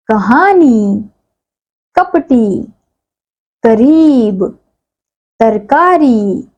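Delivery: each word said clearly and apart, with pauses between; word list read aloud. Shown as a demonstration. Teacher reading a word list for the children to repeat, clearly. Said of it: "शब्दों का उच्चारण कीजिए".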